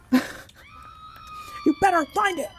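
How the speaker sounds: a slow, very high mock screech of the threat; mock screamed threat. Retelling the fight-club guy's threat as a squealing mock yell. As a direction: stretch it into a shrieking squeal. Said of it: "And he goes".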